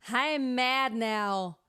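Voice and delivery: slow, loud mock anger; mad. Shown as a demonstration. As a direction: bark it like you're really mad. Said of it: "as if you were really mad".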